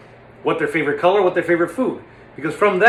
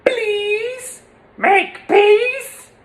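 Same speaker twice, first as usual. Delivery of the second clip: much higher, louder whining plea; Chamberlain impression; 4 dB louder, much slower than usual. Chamberlain impression pleading, high and whining. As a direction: beg for peace like Chamberlain. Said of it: "that's more of an impersonation".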